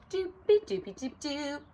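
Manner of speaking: light, high sing-song doodling; carefree doopy dooping. Acted. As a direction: doop along without a care. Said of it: "just, like, doopy dooping along".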